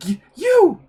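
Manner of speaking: high, loud stammered cry; stammer, then cry out the accusation; shocked accusation. Acted. Confronting a recognised enemy, loud stammered accusation.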